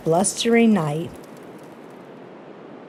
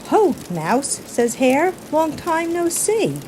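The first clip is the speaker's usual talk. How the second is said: hare greeting; 8 semitones higher than usual. Acted. Hare's greeting voiced.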